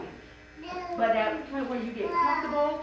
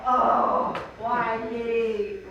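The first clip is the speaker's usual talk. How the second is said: a half-sobbed, breathy oh, then a plaintive why me; mock tearful lament; retold crying wail; 3 semitones lower, 5 dB louder, much slower than usual. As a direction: relive the cry, wailing but not in full tears. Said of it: "I'm crying".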